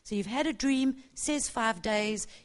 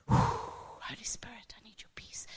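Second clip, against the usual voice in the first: whispered prayer; 4 dB quieter than usual. Retold or imitated. Stressed worker whispering to God in the loo. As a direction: whisper the prayer as if hidden away.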